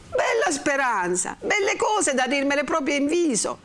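high, indignant sarcasm; bitter retort. Acted. Renzo answers Lucia's hope of forgetting, bitterly indignant. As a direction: fling it back with hurt scorn.

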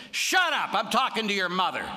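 high loud snappy comeback; old lady telling Jesus off, loud and high; irritated retort. Retold or imitated. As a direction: snap back at him without stopping the prayer. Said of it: "looked up and said".

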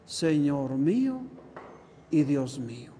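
low, slow, reverent declaration; profound affirmation. Retold or imitated. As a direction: draw it out low, as a deep confession of faith. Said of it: "qué afirmación tan profunda".

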